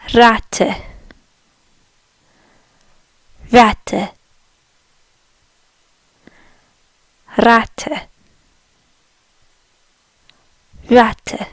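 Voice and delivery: Ratte with a rolled-back R and a short final e; German Ratte. Shown as a demonstration. Teacher modelling Ratte for learners, careful German pronunciation. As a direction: say Ratte crisply, two clear syllables. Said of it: "The word for rat in German is".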